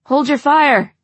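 loud command to stop firing; shouting. A narrator's reading. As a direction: bark the order at full volume. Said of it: "someone shouts".

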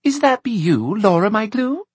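put-on high, unsure little-girl question; hesitant. A narrator's reading. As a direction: ask as if not quite believing, high child voice.

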